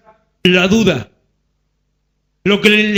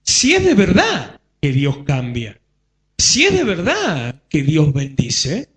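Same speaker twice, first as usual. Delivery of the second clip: sow doubt slowly like the devil; a hint of a sneering liar; sly devil voice; 6 semitones lower than usual. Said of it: "viene y te dice".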